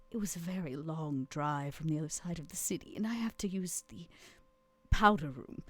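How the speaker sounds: soft, half-whispered confiding; hushed Vera. Acted. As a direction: drop to a near whisper. Player voices Vera sneaking.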